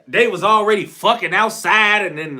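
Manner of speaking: ordinary delivery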